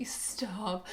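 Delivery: breathy whispered stop. Real, not acted. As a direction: breathe it out in disbelief. Shocked reaction.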